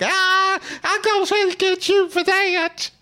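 high squeaky mock girl threat; high falsetto girl, slowed. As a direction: squeal it like the girl being hit. Girl voiced in retold fight.

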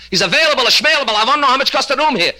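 high, loud Yiddish guest losing patience; angry shout. Retold or imitated. Guest shouting at the stubborn hotel clerk, loud and high.

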